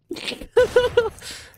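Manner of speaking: high, loud cackle; high-pitched burst of mocking laughter; taunting laugh. Real, not acted. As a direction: laugh mockingly at their threat. Taunting officer, laughs in his face.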